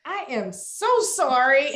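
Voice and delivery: put-on drawn-up Southern-accented apology. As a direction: lay on the Southern charm to get off a ticket. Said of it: "you hear that accent".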